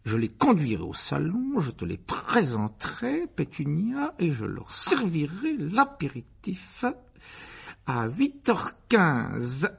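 slow, ceremonious, swooping uncle; pompous Uncle Vernon planning. A narrator's reading. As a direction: savour each step of the programme. (oncle pompeux, lent et cérémonieux)

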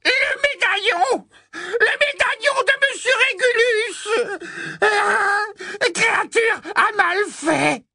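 very high, loud anguished shriek; blood-curdling elf cry. Acted. Kreacher shrieks in despair over the lost locket. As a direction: scream it like a broken servant. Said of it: "un cri à glacer le sang".

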